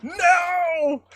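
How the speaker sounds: very high hammed-up wail; mock terror. Kids realizing it was not mom, high comic panicked wail. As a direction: squeal it like a panicking kid, half laughing. Acting out the kids' terrified reaction.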